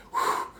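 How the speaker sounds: imitated breathy exhale of exhaustion; mimicked wife's winded sigh; worn-out whew. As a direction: blow out a tired puff of air. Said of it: "she's all like".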